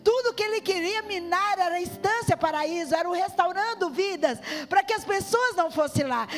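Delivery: loud, high, driving preacher's proclamation; full-force preaching. Acted. Preacher telling the church how the devil fought her, loud and fervent. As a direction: hammer home God's plan.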